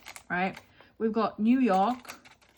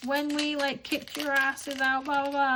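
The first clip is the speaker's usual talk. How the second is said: higher-pitched taunting comeback; put-on higher voice for a goading partner; mocking husband imitation; 6 semitones higher than usual. Husband taunting his British wife about history, mocking imitation. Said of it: "And he's like".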